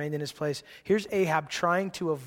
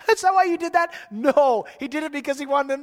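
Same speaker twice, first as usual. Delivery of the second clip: laughing; 8 semitones higher, 6 dB louder than usual. Real, not acted. Laughing at Ahab's trick.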